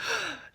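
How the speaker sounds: high-pitched gasp of astonishment; mock shocked gasp. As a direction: gasp like a scandalised friend. Friend's shocked gasp, retold.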